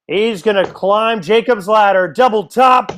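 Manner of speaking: thrilled darts commentator's climax; excited play-by-play. Real, not acted. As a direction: call the big checkout with building excitement. Calling a high checkout live.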